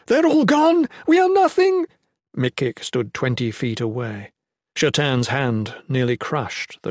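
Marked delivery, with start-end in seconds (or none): 0.1-1.9 very high, loud wail of despair; panicked exclamation. A narrator's reading. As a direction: cry out as if all is lost. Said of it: "Nolan exclaimed".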